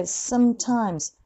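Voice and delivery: slow "sometimes", short light s; slow model. Shown as a demonstration. Teacher modelling the word slowly for students. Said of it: "someのsomeがあんまりさーっとならない".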